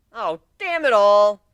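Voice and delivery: loud, slow exasperated cry; frustrated outburst. Acted. Narrator jealous of Aoi, loud exasperation. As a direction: let it burst out in frustration.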